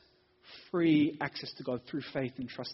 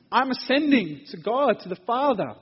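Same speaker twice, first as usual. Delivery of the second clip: louder and higher, proclaiming; emphatic preaching; 8 semitones higher, 10 dB louder than usual. Acted. Preacher voices Jesus' message to the disciples, emphatic. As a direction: proclaim it to the room.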